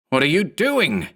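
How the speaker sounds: yell. A narrator's reading. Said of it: "Mark yelled".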